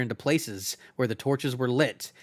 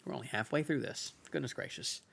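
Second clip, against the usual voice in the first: muttered aside; 14 dB quieter, faster than usual. Real, not acted. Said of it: "aside to listeners".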